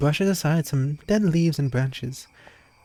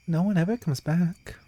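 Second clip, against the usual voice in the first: ordinary talk, nothing marked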